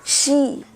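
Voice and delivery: "शी" with a clear sh, as shee; a drawn-out shee on "शी", never see; श का उच्चारण. Shown as a demonstration. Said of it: "शीतोष्ण नहीं". ("शी" में साफ़ श की ध्वनि)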